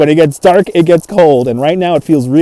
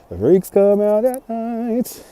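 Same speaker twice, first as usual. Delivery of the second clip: slow, soft, spooky; softer, slow eerie delivery; spooky quote; 4 semitones higher, 7 dB quieter, slower than usual. Acted. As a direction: draw it out slowly like a creepy movie line. Quoting a chat line dramatically.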